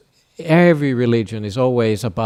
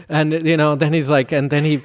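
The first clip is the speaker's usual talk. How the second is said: normal talking voice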